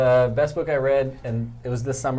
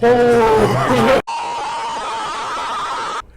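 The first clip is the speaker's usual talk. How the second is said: long high laugh that builds to a shout; loud laughing fit; 10 semitones higher, 6 dB louder than usual. Real, not acted. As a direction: laugh hard through the burn. Reeling after hot sauce.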